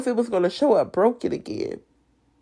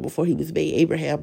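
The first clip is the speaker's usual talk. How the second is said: normal talking voice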